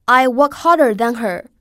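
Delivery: whole sentence joined up, loud and clear; connected model reading. Shown as a demonstration. Teacher models the sentence for students, in one flowing phrase. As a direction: run the words together in one firm phrase. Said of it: "我们来读一下这个句子".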